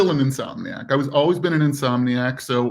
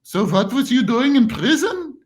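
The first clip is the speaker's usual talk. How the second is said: put-on old lady voice; 8 semitones higher, slower than usual. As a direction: squeal it like a nosy old lady. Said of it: "I said".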